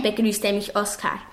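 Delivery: ordinary delivery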